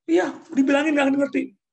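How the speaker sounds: high, loud exasperated scolding; fed-up mother yelling; angry scolding parent. Retold or imitated. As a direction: bark it at a stubborn child. Parent scolding child, acted quarrel.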